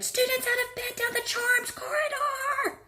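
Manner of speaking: high poltergeist bellow. A narrator's reading. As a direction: shout it down the corridor. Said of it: "Peeves bellowed".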